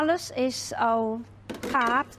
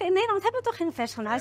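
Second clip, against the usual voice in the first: higher, fast sceptical question; imitated disbelief; 6 semitones higher, much faster than usual. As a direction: quote the doubters, quick and raised in pitch. Voices disbelieving people in a quote.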